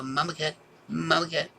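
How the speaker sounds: high, soft call to the cat. Real, not acted. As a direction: coax the cat gently. Calling the cat.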